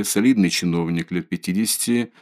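usual speaking voice

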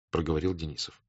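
ordinary delivery

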